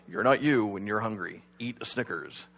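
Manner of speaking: low, soft ad voiceover; commercial narrator. Retold or imitated. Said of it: "then the narrator comes on".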